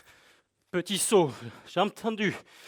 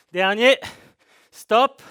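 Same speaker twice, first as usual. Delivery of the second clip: loud rising coaching calls; shouted cues; 3 semitones higher, 8 dB louder, slower than usual. Real, not acted. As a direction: call out the finish. Coach calling last rep, stop.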